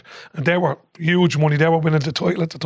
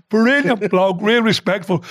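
ordinary talk

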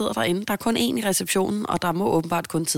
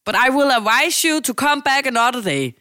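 higher, louder, slow officious English; stern receptionist; 7 semitones higher, 6 dB louder than usual. Retold or imitated. Receptionist turning the visitor away, slow loud officious English. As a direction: brush her off with stiff authority. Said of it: "og så var hun sådan".

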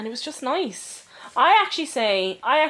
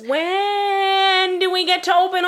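exasperated plea; 9 semitones higher, 5 dB louder, slower than usual. Real, not acted. Exasperated plea about restaurants reopening.

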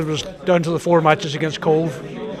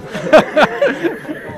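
hearty laugh; 11 semitones higher than usual. Real, not acted. Laughing at his own joke.

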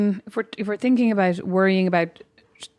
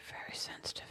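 whispered; 23 dB quieter than usual. Real, not acted. Teasing aside about sister's touchiness.

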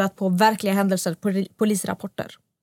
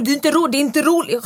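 raised, higher protesting voice; retold scream; 5 semitones higher, 4 dB louder than usual. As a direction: imitate him yelling in protest. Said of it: "han bara skriker på mig".

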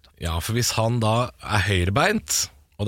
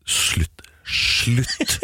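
whispered. Acted. Mock rant at handball fans.

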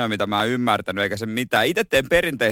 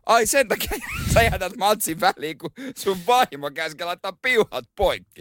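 mock coach disbelief; 6 semitones higher than usual. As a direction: play the baffled coach. Voicing coach Lampard's reaction.

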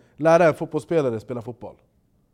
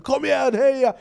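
cheering; 8 semitones higher than usual. Retold or imitated. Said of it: "då är jag engagerad".

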